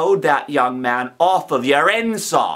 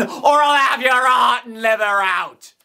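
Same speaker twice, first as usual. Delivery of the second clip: threatening shout; 10 semitones higher than usual. Acted. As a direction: shout the threat to terrify the boy. Convict threatens the boy.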